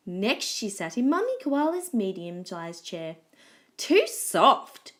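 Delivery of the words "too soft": higher, louder grumble of dislike; loud complaint. A narrator's reading. Goldilocks sinking into Mummy Koala's chair, complaining. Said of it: "she complained".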